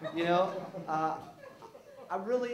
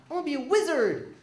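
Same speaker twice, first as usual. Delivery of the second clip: put-on high, loud childish boast; a little kid proudly announcing a dream; excited kid; 12 semitones higher, 6 dB louder, faster than usual. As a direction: kid shouting his big dream. Child excitedly telling mom dream.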